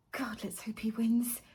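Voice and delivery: whispered sarcastic aside; snide whisper. Acted. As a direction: breathe it out snidely. Whispered snark.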